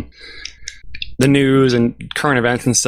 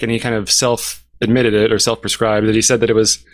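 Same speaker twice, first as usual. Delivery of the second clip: normal talking voice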